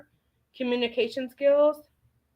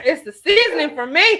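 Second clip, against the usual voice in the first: laughing; 5 semitones higher, 6 dB louder than usual. Real, not acted. Laughing about beards.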